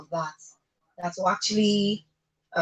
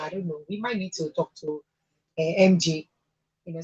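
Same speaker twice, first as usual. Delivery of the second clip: normal talking voice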